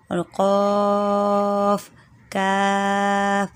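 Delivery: slow Quran letter recitation; Qaf and Kaf demo. Shown as a demonstration. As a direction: stretch each letter name slowly. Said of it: "اور اس کے پڑھنے کا طریقہ".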